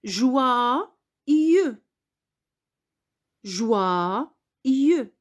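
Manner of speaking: joyeux drawn out as jou-a-i-eu; syllable by syllable, like reading to a class; careful model reading. Shown as a demonstration. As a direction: say Joyeux slowly, splitting the y into two i sounds. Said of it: "je vais lire le mot".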